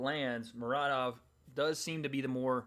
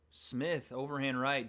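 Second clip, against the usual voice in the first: ordinary talk, nothing marked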